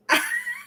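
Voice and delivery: high, soft burst of laughter; laughing. Real, not acted. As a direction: crack up in high little bursts. Laughs mid quote.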